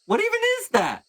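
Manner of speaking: very high, squawked question; high-pitched acted bewilderment; alarmed disbelief. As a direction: blurt it out in mock alarm. GM voices alarmed camp mates.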